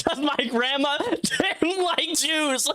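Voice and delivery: cracking up mid-sentence; reading it out and losing it; laughing. Real, not acted. Rereads the donation, breaks up.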